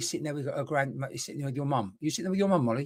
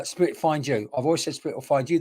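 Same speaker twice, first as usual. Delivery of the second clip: normal talking voice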